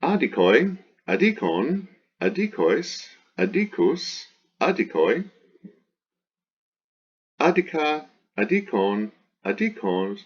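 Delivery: high singsong chant of word forms, each swinging up; paradigm recitation. Shown as a demonstration. Teacher reciting the declension, high singsong drill. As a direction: drill each form separately with an exaggerated pitch accent.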